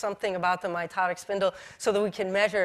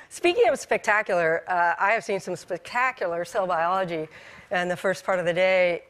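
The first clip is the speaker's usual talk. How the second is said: usual speaking voice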